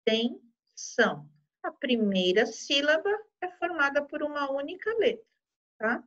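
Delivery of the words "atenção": "Atenção" stretched slow, each syllable apart. Shown as a demonstration. Teacher splitting a word for pupils, very slow. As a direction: sound out "Atenção" very slowly. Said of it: "Olha aqui".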